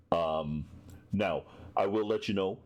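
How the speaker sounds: ordinary delivery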